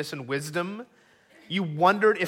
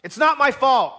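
exasperated, raised-voice outburst; Moses shouting; 6 semitones higher, 6 dB louder than usual. Acted. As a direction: shout down an angry crowd. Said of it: "Moses just shouts".

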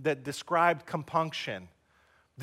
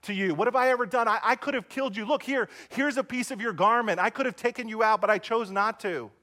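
higher, louder plea to Saul; calling out; 6 semitones higher, 4 dB louder than usual. Retold or imitated. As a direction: call across to an enemy, urgent and raised. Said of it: "David calls for Saul and says".

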